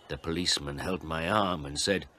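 ordinary delivery

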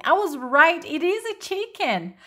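high-pitched, triumphant vindication; exclaiming. A narrator's reading. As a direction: crow about being proved right. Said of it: "exclaimed Marilyn".

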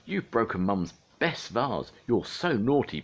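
high, aghast telling-off; scolding little sister. A narrator's reading. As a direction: scold like a shocked child. Sister scolds after gasp.

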